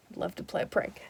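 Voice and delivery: hushed, conspiratorial whisper; whispered agreement. Retold or imitated. Hal agreeing to secret prank.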